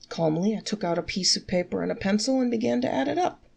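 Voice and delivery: usual speaking voice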